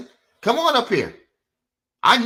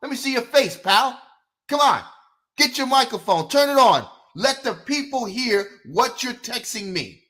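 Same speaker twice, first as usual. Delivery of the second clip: calling out challenge; 8 semitones higher than usual. Retold or imitated. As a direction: call out the hidden commenters. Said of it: "I say".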